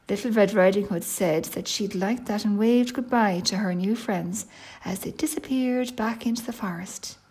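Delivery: plain reading voice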